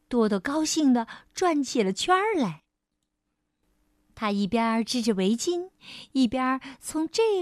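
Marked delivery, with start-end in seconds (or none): none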